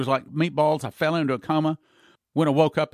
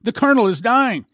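high, loud panicked yell; 8 semitones higher, 7 dB louder than usual. Retold or imitated. Officer yelling to the doctor, loud and panicked. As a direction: shout the alarm, officer in a rush. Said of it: "came yelling".

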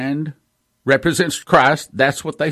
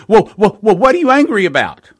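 lightly mocking reporter, higher and louder, fast; reporter asking; 7 semitones higher, 5 dB louder, faster than usual. News reporter questioning people on the street, mock higher voice. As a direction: poke the mic at them quickly. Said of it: "and they say".

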